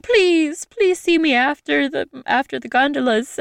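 high, begging gondolier voice; pleading gondolier. Retold or imitated. Gondolier begging her to meet after his shift, high pleading. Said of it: "And he was like".

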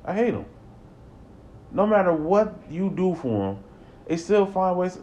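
high, loud exasperated outburst; a ranting parent, half joking; mock rant. Real, not acted. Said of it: "I'm not ranting".

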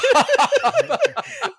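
big high burst of laughter; real laughter at a movie line. Real, not acted. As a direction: crack up hard.

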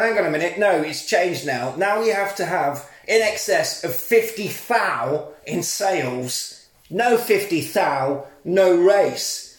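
slow, drawn-out rule announcement; slow, self-important decree; pompous officials. Retold or imitated. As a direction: lay down the new rule slowly and smugly.

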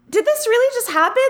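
high, amazed disbelief. Retold or imitated. Friend retelling her shock, high and disbelieving. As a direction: squeak it out in wonder.

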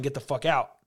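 normal talking voice

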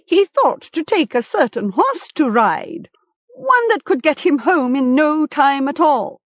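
high-pitched, insinuating jeer; sarcastic chieftain. A narrator's reading. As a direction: play to the crowd at the captive's expense.

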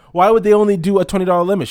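high, fast, loud rant; exasperated complaint. Retold or imitated. He vents to the clerk about the limit, exasperated high rant. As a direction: throw up your hands.

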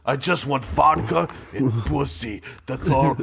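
put-on slow, comic Russian accent. Comic plays Russian Kool-Aid stating demands, slow Russian accent. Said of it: "you do a mean Russian".

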